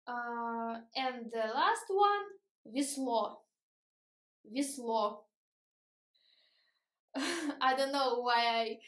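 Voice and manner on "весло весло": "весло" as vi-SLO; short i in the first syllable, stressed final O in "весло"; unstressed Е as И. Shown as a demonstration. Teacher giving the last example, "весло" with i for е. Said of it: "but we pronounce и".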